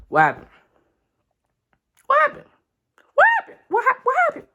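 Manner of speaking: each "what happened" climbs into a shriek; building disbelief. Real, not acted. Stunned fan yelling at the page, escalating to a shriek.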